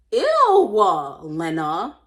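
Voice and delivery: loud disgusted "Ew, Lena"; reacting to Lena with loud disgust. Real, not acted. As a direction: recoil loudly at her name.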